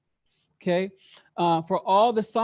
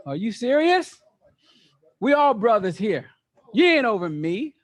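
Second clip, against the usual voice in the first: indignant, louder challenge to a leader; defiant rebel; 4 semitones higher, 7 dB louder than usual. Retold or imitated. Teacher voicing rebels who reject Moses, indignant and loud. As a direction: scoff at anyone placed over you.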